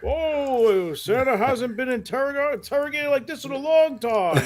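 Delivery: jolly Santa dodging a tricky question. Retold or imitated. Santa answering the parents.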